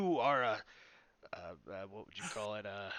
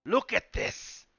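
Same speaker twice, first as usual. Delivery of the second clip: slow, loud, higher "Look at this!"; excited showman; 6 semitones higher, 5 dB louder, much slower than usual. Acted. Player showing the goblin the mask, drawn-out excited cry. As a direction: thrust the mask forward with glee.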